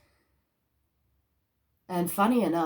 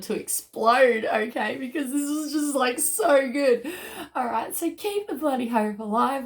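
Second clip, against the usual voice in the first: bursting with real excitement; so excited; 7 semitones higher, 3 dB louder than usual. Real, not acted. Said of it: "freaking out and so excited".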